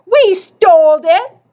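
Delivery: put-on high, loud blurt from a small boy. A narrator's reading. Kid blurting the theft to a stranger, high and loud. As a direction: let it burst out before thinking. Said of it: "Screecher blurted".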